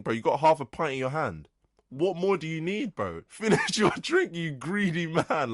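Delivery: high, loud, incredulous tirade; exasperated rant. Retold or imitated. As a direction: berate a customer in indignant disbelief. Acted rant at the greedy customer.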